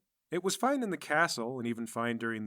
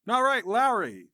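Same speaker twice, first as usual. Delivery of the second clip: calling out; 8 semitones higher, 7 dB louder than usual. Acted. GM voicing guard calling out.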